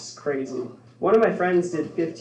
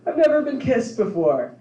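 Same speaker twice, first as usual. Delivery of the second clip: mock sobbing woman; 7 semitones higher than usual. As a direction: play her as a crying woman. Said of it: "And she's like".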